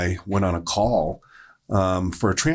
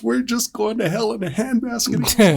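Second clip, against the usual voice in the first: higher-pitched retold upset grumble; 4 dB louder than usual. Retelling an upset cancer patient's rant, mock upset. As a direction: imitate an upset man's raised-voice complaint. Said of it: "he was just so upset".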